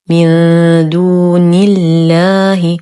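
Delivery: slow من دون الله, long hum then thin doubled ل in الله; light laam. Shown as a demonstration. Teacher shows the Name of Majesty after kasra, light laam. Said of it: "تشديد اللام المرققة في اسم".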